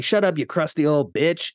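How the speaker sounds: hammed-up heckle, not fully shouted; retold yell. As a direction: imitate a thug yelling up at an old woman. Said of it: "and they yell up".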